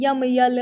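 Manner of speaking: "यम, यल" with a y sound before the letters; wrong "yam" pronunciation. Shown as a demonstration. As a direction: say "यम, यल" the wrong way, with a y glide up front. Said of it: "वो गलत pronunciation है". ("यम, यल" गलत उच्चारण, आगे य की आवाज़ के साथ)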